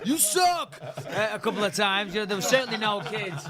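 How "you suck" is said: high, drawn-out mock jeer; imitated street taunt, pitched up; mock heckler. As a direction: call it out slowly like a heckler. Retold heckling from pizza guys.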